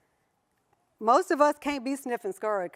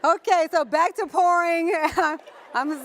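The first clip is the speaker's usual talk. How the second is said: laughing; 4 semitones higher, 4 dB louder than usual. Real, not acted. Laughing after the tin joke.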